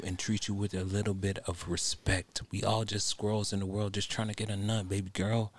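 ordinary talk

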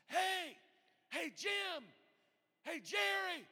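high-pitched calls by name; calling out. Acted. The Lord calling us one by one, calling out. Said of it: "And he's calling us".

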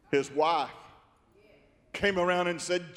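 normal talking voice

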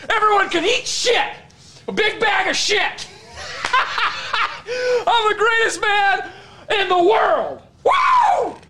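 high, shouting victory boasts; cocky breathless boxer boasts. Acted. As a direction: crow about the win, laughing. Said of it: "quick recap of the Liv saga".

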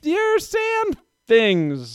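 put-on very high, very slow child voice; slow toddler voice. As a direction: stretch out "your sand things" like a toddler. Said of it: "she said it like that".

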